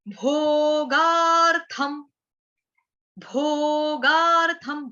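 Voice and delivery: भोगार्थम slowly with a strong aspirated भ; forceful bh. Shown as a demonstration. Teacher showing the forceful भ in भोगार्थम. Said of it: "ताकत लगेगी".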